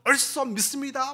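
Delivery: high, eager shout of agreement; crowd cheer. Retold or imitated. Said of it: "막 그런 겁니다".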